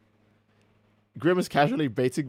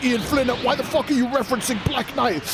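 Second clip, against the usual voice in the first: mock outraged fan; 9 semitones higher than usual. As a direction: play an irritated fan calling out the writer. Fan griping about the reference.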